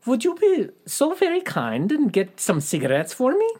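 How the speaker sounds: high, bad German accent on the killer's line; terrible German accent. Podcaster voicing the killer's request in a terrible German accent. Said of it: "That was a terrible German accent".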